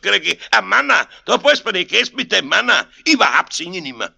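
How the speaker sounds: high, loud Bavarian tirade; ungracious scolding. A narrator's reading. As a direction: refuse loudly, in an indignant rush. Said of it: "er war einmal im Schimpfen drin". (ungnädiges, lautes Schimpfen)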